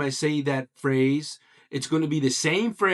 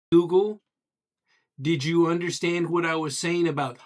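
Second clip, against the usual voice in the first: ordinary talk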